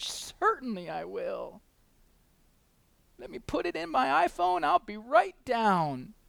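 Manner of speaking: a touch of mock cheerfulness; mock breezy Jesus. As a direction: send up an all-too-obliging Jesus. Mocking an obliging pocket Jesus.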